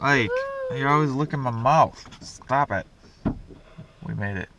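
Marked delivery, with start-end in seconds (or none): none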